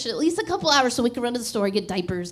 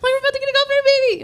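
much higher, louder and fast, near squeal; thrilled; 9 dB louder, much faster than usual. Retold or imitated. Mother shouting the baby news to her family, thrilled. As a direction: burst out with the news.

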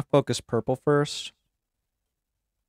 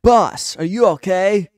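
high, loud worried call; acted loud, high concern; alarmed underling; 8 semitones higher, 10 dB louder, slower than usual. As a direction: call out to your boss in alarm. Underling calls to fallen boss.

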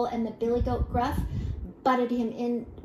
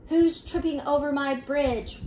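higher-pitched troll challenge, a mild roar; 5 semitones higher, 4 dB louder than usual. A narrator's reading. Troll challenges the youngest goat, mildly roaring. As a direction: demand it as a riled troll, raised in pitch. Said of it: "roared the troll".

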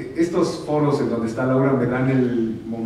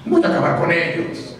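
low, slow growling Grinch; 8 semitones lower, 5 dB louder, much slower than usual. Retold or imitated. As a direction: sneer it like the Grinch. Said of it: "El Grinch".

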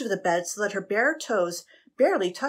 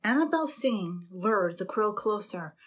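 ordinary delivery, nothing marked

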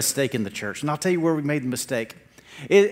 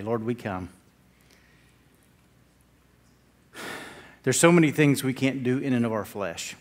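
soft, hushed prayer voice; quiet prayer; 5 semitones lower, 8 dB quieter than usual. Acted. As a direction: pray over the people, gently. Leads the congregation in prayer.

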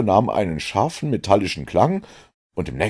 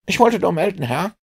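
put-on much higher, perky boy's report; young Wiggins standing to attention, reporting; eager street boy; 13 semitones higher than usual. A narrator's reading. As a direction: snap to attention and report brightly. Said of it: "sagte er, eine stramme Haltung annehmend".

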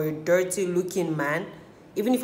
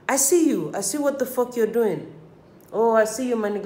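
mock knowing callout; 5 semitones higher, 4 dB louder than usual. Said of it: "I'm like".

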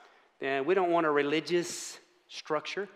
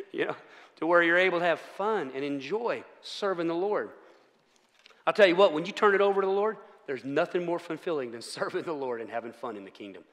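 usual speaking voice

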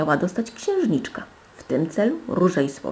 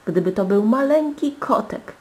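delighted higher-pitched cry; joyful exclamation; 6 semitones higher, 4 dB louder than usual. A narrator's reading. Princess hoping for a kitten in the box, joyful exclamation. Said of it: "klasnęła z radości w ręce i zawołała".

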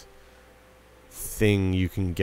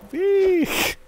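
squeal; 4 dB louder than usual. Real, not acted. Squeals at the game.

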